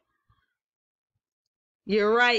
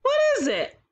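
much higher, sing-song quiz question; playful question; faster than usual. Acted. Teacher quizzing young children, high and playful.

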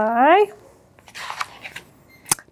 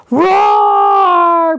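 loud dinosaur roar; 3 semitones higher, 5 dB louder than usual. Acted. Greeting a pizza dinosaur.

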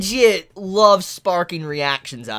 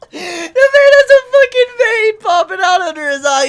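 much higher, louder, cracking up; laughing; 13 semitones higher, 9 dB louder than usual. Real, not acted. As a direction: shriek through the laughter. Laughing at Kuri's vein.

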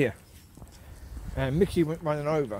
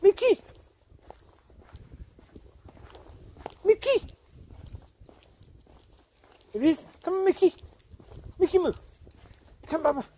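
calling the dog; 13 semitones higher than usual. Real, not acted. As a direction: yell for him to come back.

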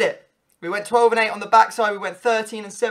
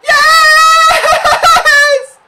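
screaming yes much higher and louder with a laugh; ecstatic shout; 15 dB louder than usual. Real, not acted. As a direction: explode with joy. Winning goal.

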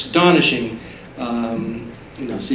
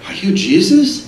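surprised question; 11 semitones higher, 5 dB louder than usual. Retold or imitated. Disciples asking at dinner.

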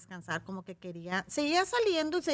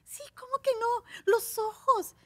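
much higher, eager fan voice; insistent fan; 4 dB louder, slower than usual. Retold or imitated. Fan insisting after her denial, high eager voice. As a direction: press her like a fan who won't be fooled. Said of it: "No, no soy".

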